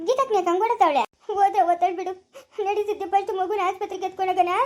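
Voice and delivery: ordinary delivery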